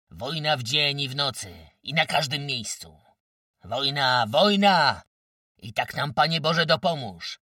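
loud, high rallying shout; booming war cry. A narrator's reading. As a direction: thunder it out like a battle oath. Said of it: "huknęli wraz".

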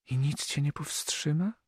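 put-on high girl's plea, understated break. A narrator's reading. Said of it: "zapytała łamiącym się głosem". (lekko łamiący się głos)

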